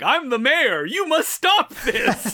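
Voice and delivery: lightly voiced mayor, high and slow; mock-official mayor demanding order; a hint of a stuffy mayor; pompous mayor. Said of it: "he's like".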